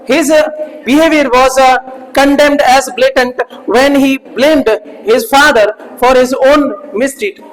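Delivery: very slow, word by word reading; slow dictation. Shown as a demonstration. Teacher reads example sentence slowly to class. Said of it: "dictating example sentence".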